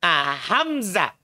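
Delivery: "Hamzah" slowly, z buzzed not s; slow careful Hamzah. Shown as a demonstration. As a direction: stretch "Hamzah" and buzz the z. ("Hamzah" pelan dengan z berdengung)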